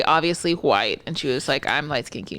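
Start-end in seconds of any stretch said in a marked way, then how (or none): 1.7-2.4 low, soft mocking voice; quiet mock voice.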